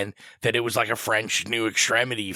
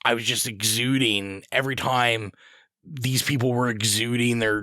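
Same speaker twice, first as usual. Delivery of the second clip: ordinary talk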